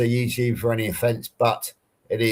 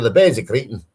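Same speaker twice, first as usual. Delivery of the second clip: short Scottish dialect demo, a bit louder; put-on Scottish accent; 6 dB louder than usual. Shown as a demonstration. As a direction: say it as a Scot would. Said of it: "if I was to try and speak, speak Scottish to you".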